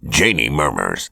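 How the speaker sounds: ordinary delivery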